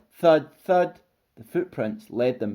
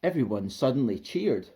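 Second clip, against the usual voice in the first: usual speaking voice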